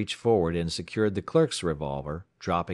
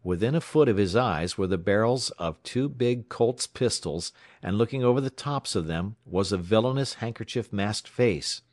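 ordinary delivery

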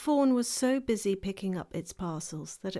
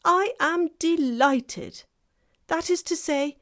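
higher, louder and slow, delighted; 9 semitones higher, 7 dB louder, slower than usual. Acted. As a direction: savour each word of delight. Fawn excited.

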